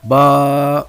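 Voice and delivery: با said short and loud with a heavy sound; heavy Ba again. Shown as a demonstration. Teacher repeats the letter با for students, loud and heavy. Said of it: "اس کی آواز بذات ہی خود موٹی ہے".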